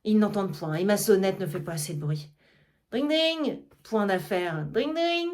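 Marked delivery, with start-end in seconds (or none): 2.9-3.6 bell imitation. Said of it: "Il sonne une sonnette". 4.7-5.3 higher ringing "dring, dring" like a hand bell; bell imitation. Argan ringing for his servants, mimicking a bell. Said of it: "Il sonne une sonnette".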